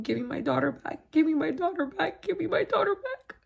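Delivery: pleading, pitch climbing steeply with each repeat; retold desperate screaming. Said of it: "I was screaming and yelling at him".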